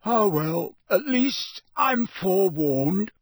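high, loud yawn through the words; drawn-out, yawny resignation. A narrator's reading. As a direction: stretch slowly into a yawn as you speak. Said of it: "Ron yawned".

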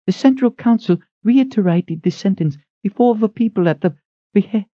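high, brisk woman's voice; thin head cook. A narrator's reading. Head cook rushes in asking who calls, high. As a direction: ask it sharp and high.

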